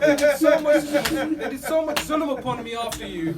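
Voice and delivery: loud, slow, grieving wail; crying out. Acted. Daughter crying out to her father's head, loud and slow. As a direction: cry out slowly over the father's head. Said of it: "cried out".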